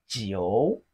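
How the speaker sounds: "jiu" dipping low, then rising; third tone. Shown as a demonstration. Teacher models Chinese nine for learners, third-tone "jiu". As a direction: draw out "jiu" from low pitch to a rise. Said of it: "It has the third tone".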